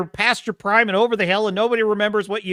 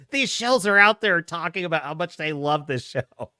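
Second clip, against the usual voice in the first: ordinary talk, nothing marked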